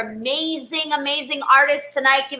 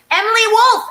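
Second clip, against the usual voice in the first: high, loud shout of the name; excited call out; 10 semitones higher, 5 dB louder, faster than usual. Real, not acted. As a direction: announce her like a star. Host calls out performer.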